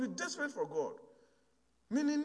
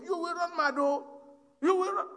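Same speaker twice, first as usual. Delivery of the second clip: higher and louder, rising as it goes; loud warning; 8 semitones higher, 4 dB louder than usual. Retold or imitated. Coffin owner warns the brothers not to read it, urgent raised warning.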